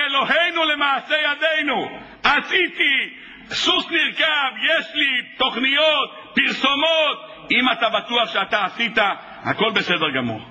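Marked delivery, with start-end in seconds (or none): 0.1-7.5 slow, higher, shouted bragging; mock boast. Preacher mocks a self-reliant man, slow shouted bragging. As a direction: crow over your own achievements.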